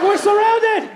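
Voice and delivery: high, loud mock battlefield panic; alarmed yell. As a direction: cry out to comrades in hammed-up alarm. Acted soldier ambushed on battlefield.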